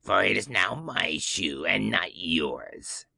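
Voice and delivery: low, slow witch voice, gloating; the witch claims the shoe as hers; slow low old-witch gloat; retorting witch. Acted. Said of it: "retorted the witch".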